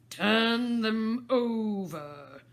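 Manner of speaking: loud, slow curt order; angry command. Acted. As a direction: bark it over your shoulder. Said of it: "The Queen turned angrily away".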